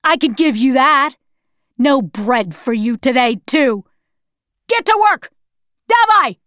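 furious officer ending in shouted command; screaming officer. A narrator's reading. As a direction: yell orders at a prisoner. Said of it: "screamed the other officer".

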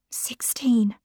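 frightened whisper. Acted. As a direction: breathe the word out in fear. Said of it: "Felicity whispered".

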